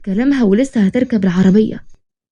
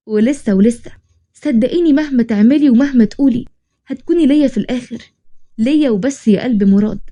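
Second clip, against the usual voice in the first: usual speaking voice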